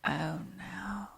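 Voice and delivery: soft unvoiced whisper of dismay; whispered dread. Real, not acted. Listener whispers in dismay as grandpa is dragged away. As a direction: breathe it out in horror. Listener reacts to grim story.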